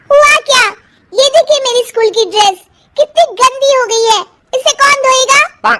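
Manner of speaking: put-on a spoilt girl demanding; bratty daughter. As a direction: daughter whining about her dress.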